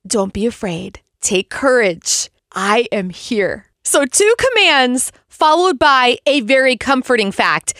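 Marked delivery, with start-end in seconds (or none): 0.1-3.7 whisper-close comfort to the listener; tender reassurance. Retold or imitated. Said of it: "He is speaking to you in that and saying".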